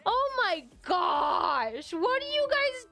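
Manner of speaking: slow, loud, stunned exclamation; stretched-out disbelief at chat; overwhelmed surprise. Real, not acted. Chat gifting subs, overwhelmed.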